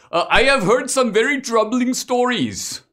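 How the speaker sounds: mock Indian accent, high and loud; a polite immigrant pitching himself, hammed-up high voice; Mister Gadde. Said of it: "imaginative rendition".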